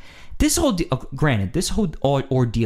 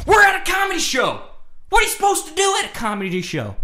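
mock drunk yelling; 14 semitones higher, faster than usual. Drunk guy yelling back at me, mock indignant shout. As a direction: yell it like a belligerent drunk. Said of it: "then he starts yelling other things".

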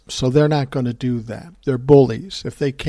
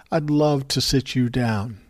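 normal talking voice

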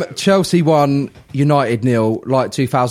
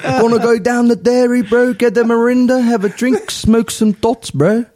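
high-pitched mock Kiwi accent; Kiwi Maori accent; 9 semitones higher, slower than usual. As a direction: put on a high Kiwi bro voice. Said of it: "worst Kiwi Maori accent".

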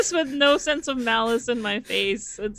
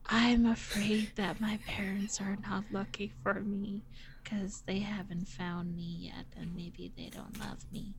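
fading to a soft whisper; 2 semitones lower, 10 dB quieter than usual. Real, not acted. Cat player redoing the line as a whisper. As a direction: whisper it like a secret. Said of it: "Oh, I didn't whisper it".

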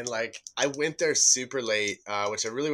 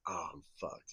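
hushed dread; 5 semitones lower, 13 dB quieter than usual. Retold or imitated. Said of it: "which really fucking is like".

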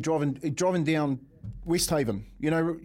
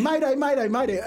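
mock panicked mayday; 9 semitones higher than usual. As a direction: ham up a frantic wife calling mayday. Wife panics on phone, retold.

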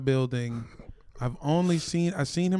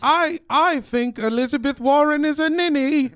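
transatlantic voice; 8 semitones higher than usual. Pretend. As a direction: play a stuffy old-world gentleman. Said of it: "the transatlantic voice".

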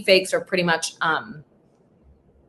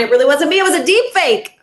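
higher, louder frantic protest; imagined victim pleading online, higher and louder; desperate pleading; 8 semitones higher, 5 dB louder than usual. Retold or imitated. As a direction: beg people to believe you. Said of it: "trying to explain to everybody online".

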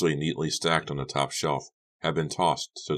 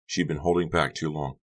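plain reading voice

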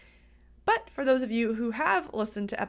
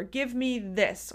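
usual speaking voice

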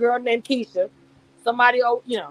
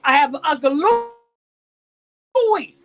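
Pooch; 3 semitones higher, 4 dB louder than usual. Acted. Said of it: "If he says".

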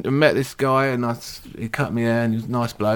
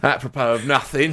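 ordinary delivery

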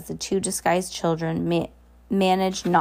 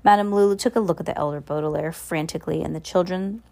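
ordinary delivery, nothing marked

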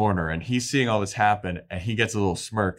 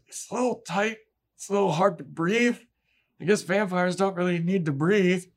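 much higher, airy voice squeezed by a tight corset; mock-dainty complaint, pitched up high; breathless girlish Willow; 10 semitones higher than usual. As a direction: play Willow who can barely breathe in her costume.

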